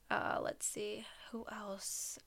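soft unvoiced whisper to herself; whispered aside. Real, not acted. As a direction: whisper it under your breath while thinking. Whispered aside while recalling names.

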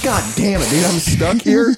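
retold exasperation. Retelling his jail reaction to officers, exasperated. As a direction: stretch it out in exasperated complaint.

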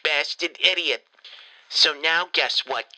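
ordinary talk

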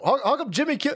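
normal talking voice

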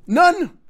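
NONE punched high and loud; emphatic none. Real, not acted. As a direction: hit None hard and high. Emphatic repeat.

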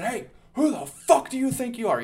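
high, loud yell after getting knocked down; retold shout. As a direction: yell it up at the bigger man, dazed and cocky. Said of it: "just fucking shouting some dumb shit".

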